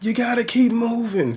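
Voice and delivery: hammed-up cornerman calling instructions; corner coach shouting. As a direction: push the fighter from the corner. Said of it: "they're like".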